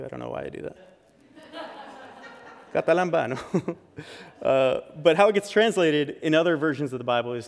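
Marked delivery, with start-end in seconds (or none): 2.9-3.8 playful Italian-accented Greek word. Shown as a demonstration. Pastor joking about his own slip, Italian accent. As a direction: roll it out in a mock Italian accent. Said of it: "with an Italian accent too".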